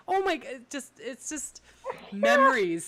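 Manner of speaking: high, loud laugh-through words; can barely speak for laughing. Real, not acted.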